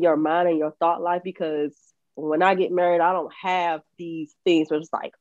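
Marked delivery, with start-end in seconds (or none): none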